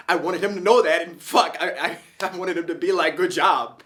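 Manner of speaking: high, loud rush of feeling with a laugh breaking in; worked-up, high-pitched and loud, aching to be noticed; excited, emotional outpouring. Real, not acted. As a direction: let the pride spill out, voice lifted and urgent. Elated recalling newfound pride and longing.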